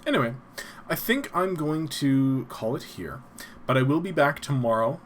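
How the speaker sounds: ordinary talk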